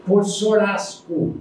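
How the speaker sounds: sorasco com S no lugar do CH, mais lento; sotaque italiano. Retold or imitated. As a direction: imitando o imigrante: sorasco. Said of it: "o churrasco é por brasileiro". (sorasco com sotaque de imigrante italiano)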